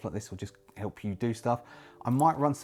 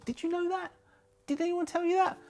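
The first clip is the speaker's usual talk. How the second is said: very high, mock-innocent questions; playfully high-pitched cooing questions; playful squeaky-high aside. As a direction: jump way up in pitch, teasing the listener. Playful aside flattering new members.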